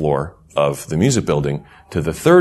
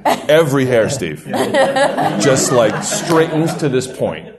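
laughing; 5 semitones higher, slower than usual. Real, not acted. Recalling the fright, laughing.

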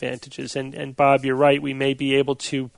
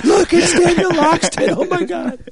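starstruck fan; 5 dB louder than usual. Pretend. Mock fans spotting author.

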